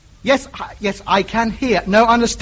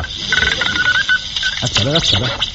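tuning whistle; much slower than usual. Acted. Tuning the radio dial.

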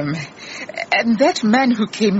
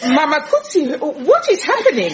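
alarmed; 8 semitones higher than usual. Acted. Alarmed at Mma Makutsi's cry.

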